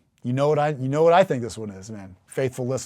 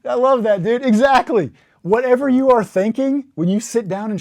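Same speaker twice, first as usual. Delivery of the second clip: much higher and louder, bursting with delight; real excitement, pitched up and loud; excited outburst; 10 semitones higher, 9 dB louder than usual. Real, not acted. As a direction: blurt it out thrilled by the joke. Bursts out at the satire.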